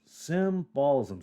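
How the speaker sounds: "symbolism" drawn out slowly, SIM-bolism; slow, deliberate retry of "symbolism"; careful sounding-out. Real, not acted. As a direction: stretch "symbolism" to half speed, syllable by syllable. Careful retry of misread word.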